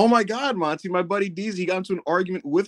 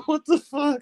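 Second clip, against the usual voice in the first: high squeal of disbelief; stunned high-pitched what the fuck; shocked outburst; 13 semitones higher, slower than usual. Retold or imitated. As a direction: yelp it in shock. Shocked reaction in retold story.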